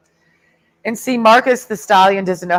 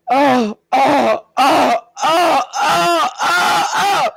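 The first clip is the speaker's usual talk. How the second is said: higher, escalating shouted oh's into a laugh; mock oh-oh shouting; 8 semitones higher, much slower than usual. Mocking the Marines, shouted rising oh's. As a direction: wail oh oh, climbing into laughter.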